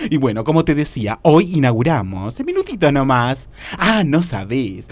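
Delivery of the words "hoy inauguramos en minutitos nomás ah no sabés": usual speaking voice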